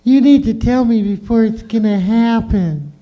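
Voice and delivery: high put-on girl's voice; demanding girlfriend. Mocking a girlfriend's demand to the crowd in a high voice. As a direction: mimic her nagging demand. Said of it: "They always want a warning".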